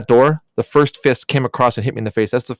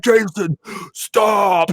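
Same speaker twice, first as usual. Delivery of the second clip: higher, urgent plea; pleading with brother; 8 semitones higher, much slower than usual. Retold or imitated. Knife in stomach, pleading with brother Jason, higher urgent plea. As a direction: beg him to stop, higher. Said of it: "I'm like".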